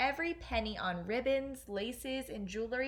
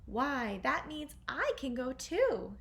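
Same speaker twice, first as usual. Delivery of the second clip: hopeful; 4 semitones higher, slower than usual. Acted. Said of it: "Cinderella said".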